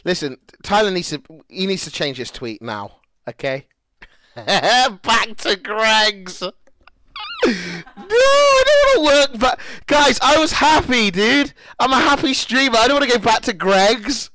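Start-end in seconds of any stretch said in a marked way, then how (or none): 4.4-14.3 high, loud, whiny mock sob; mock whining. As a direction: whine like a kid who won't go back. Whining about returning to old job.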